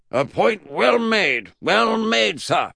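loud, high, drawn-out approval; emphatic praise, soaring in pitch; Mad Uncle Jack. Acted. Great-uncle praises Eddie's point, loud high approval.

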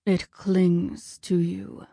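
very slow, ominous god; drawn-out, chilling god; Ares voice. A narrator's reading.

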